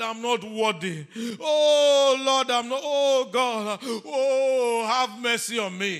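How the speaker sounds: mock wailing plea, loud, high and drawn out; hammed-up cry of begging for mercy; wailing penitent. Preacher imitating someone crying for God's mercy, loud theatrical wail. Acting a tearful repenter's cries.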